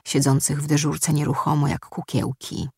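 usual speaking voice

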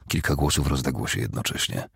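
ordinary delivery

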